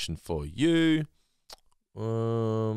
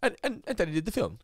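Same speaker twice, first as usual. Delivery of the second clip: put-on fancy rich kid voice; 10 semitones higher than usual. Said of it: "fancy voices".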